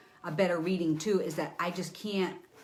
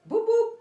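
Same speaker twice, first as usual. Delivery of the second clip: much higher, louder boop boop; imitated kicks; 7 dB louder than usual. Psychic acting out the two kicks, playful sound effects. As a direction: pop out two quick kick sounds.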